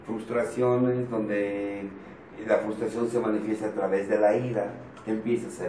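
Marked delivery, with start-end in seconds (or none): none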